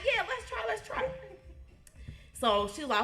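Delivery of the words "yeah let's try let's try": put-on much higher, eager kid; excited. The eager kid begging to try something new, excited. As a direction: squeal it eagerly. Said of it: "But me, I'm like".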